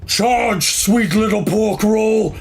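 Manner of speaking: high, loud charging yell; rally the troops with a roaring charge; battle cry. Acted. Fangboar leading the charge, loud high battle cry. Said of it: "And he goes".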